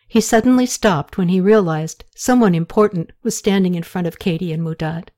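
ordinary delivery, nothing marked

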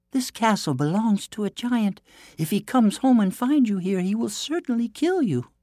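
high-pitched, urgent princess; princess crying out. A narrator's reading. Princess warns the prince about the giant, crying out high. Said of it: "she cried".

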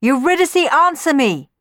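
high, loud plea; desperate begging. A narrator's reading. Orpheus kneeling beside silent Eurydice, desperate begging. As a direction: beg loudly for a response. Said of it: "he begged".

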